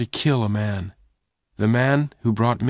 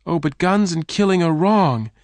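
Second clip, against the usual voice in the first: much higher, pleading cry; 10 semitones higher, 3 dB louder than usual. Acted. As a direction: protest with all your heart. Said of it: "Jane cried".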